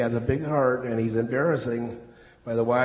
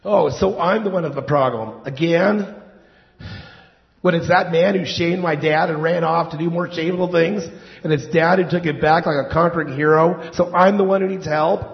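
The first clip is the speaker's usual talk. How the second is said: louder, rising, outraged protest; indignant; 3 semitones higher, 5 dB louder than usual. Acted. Resentful son protests to his therapist, indignant and loud. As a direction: defend yourself, getting more worked up.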